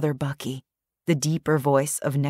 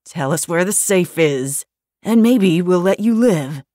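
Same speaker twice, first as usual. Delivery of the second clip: twitchy thug; 2 semitones higher, 5 dB louder than usual. A narrator's reading. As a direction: snarl it like a jittery gunman. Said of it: "said the twitchy one".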